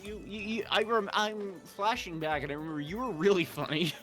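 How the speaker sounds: ordinary talk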